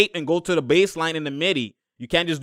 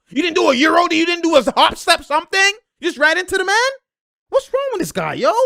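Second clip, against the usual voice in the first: high-pitched, incredulous complaint; exasperated outcry; 14 semitones higher, 4 dB louder than usual. Real, not acted. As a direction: rant at the player in high-pitched disbelief. Exasperated at player's mistake.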